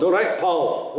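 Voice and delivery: a quick Scottish hello to a pal; Scottish accent. Shown as a demonstration. Teacher demonstrating a Scottish greeting in Scottish accent.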